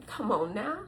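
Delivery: ordinary talk, nothing marked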